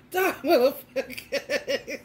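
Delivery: slow, drawn out through laughter; laughing repeat. Real, not acted. Repeating the gun line to viewers, slow and laughing. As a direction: say it again cracking up.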